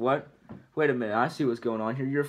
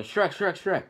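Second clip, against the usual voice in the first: panicked calling; 7 semitones higher, slower than usual. Acted. Donkey starts freaking out.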